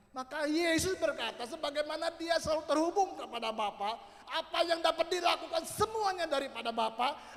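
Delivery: very loud, high-pitched sermon shouting; full-force preaching. Real, not acted. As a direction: belt it out to the congregation like a fiery preacher. (berkhotbah lantang berapi-api dengan nada tinggi)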